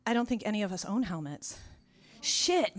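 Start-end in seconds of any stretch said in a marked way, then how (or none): 2.2-2.8 sudden curse. Retold or imitated. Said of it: "Mike says suddenly".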